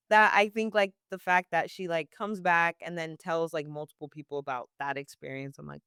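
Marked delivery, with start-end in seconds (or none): none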